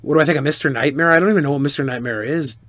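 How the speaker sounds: normal talking voice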